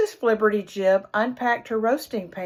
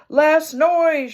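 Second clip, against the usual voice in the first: high, loud grumpy shout; 7 semitones higher, 7 dB louder than usual. Acted. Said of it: "shouted Mister Flibbertigibb".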